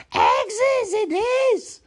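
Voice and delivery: loud, very high, drawn-out Gollum cry; high hissed screech. Acted. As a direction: screech it slowly with hissing endings. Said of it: "he hissed".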